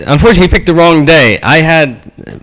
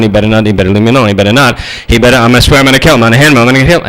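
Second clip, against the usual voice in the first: very fast, low running rant; seething inner rant; 5 semitones lower, much faster than usual. Retold or imitated. [name] in his head as the bully pokes him, fast seething. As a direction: race through the angry thoughts under your breath. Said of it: "And in my head".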